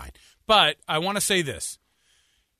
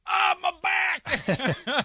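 long, high wail of pain; mock pained cry; much slower than usual. Co-host mocking the injured shopper, hammed-up high wail.

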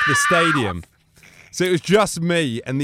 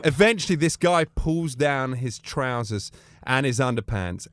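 ordinary talk

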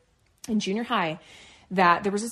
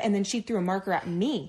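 ordinary talk

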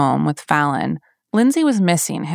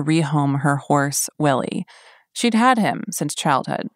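true crime narrator. Acted. Podcast narration.

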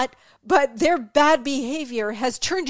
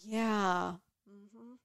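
indifferent brush-off; 2 semitones lower, 4 dB quieter than usual. Retold or imitated. Rude person brushing off complaint.

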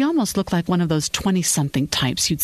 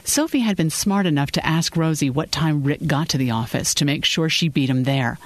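ordinary delivery

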